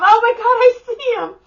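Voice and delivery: very high, loud squeal; retold excited shriek; thrilled spotting. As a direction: squeal as you finally spot him. Companion finally spots him, retold.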